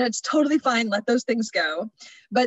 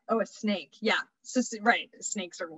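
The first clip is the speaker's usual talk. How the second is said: ordinary talk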